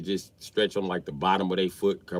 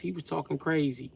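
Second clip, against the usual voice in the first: normal talking voice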